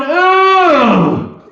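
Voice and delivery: long, high, loud "ooh"; acted cry of disgust; horrified wail. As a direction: wail it out, falling at the end. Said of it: "the son in me is just going".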